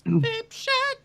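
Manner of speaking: jingle voice. Acted. Echoing the jingle.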